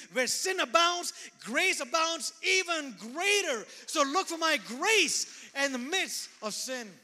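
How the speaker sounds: put-on rally the kids; speaking to children. Addressing the children directly.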